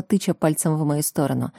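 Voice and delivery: usual speaking voice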